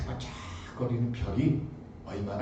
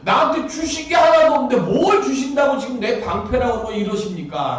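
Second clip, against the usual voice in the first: loud, high, laughing complaint; exasperated loud protest; protesting to God; 10 semitones higher, 8 dB louder than usual. Acted. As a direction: throw Abraham's grievance at God, half laughing. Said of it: "하나님께 따져요".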